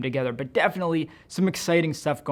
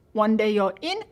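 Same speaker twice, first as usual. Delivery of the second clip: Heidi voice; 7 semitones higher, 4 dB louder than usual. Retold or imitated. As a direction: cast it like an old witch's fashion spell. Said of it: "make her sound like a, like an elderly witch".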